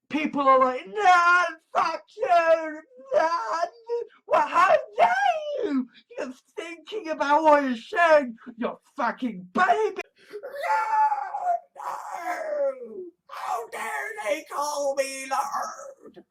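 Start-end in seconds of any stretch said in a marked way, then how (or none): 0.9-10.0 hammed-up tearful shouting; mock tantrum. Acts out furious people. 10.3-16.2 very high, drawn-out crying; mock anguished wail. Acts out anguished outraged person.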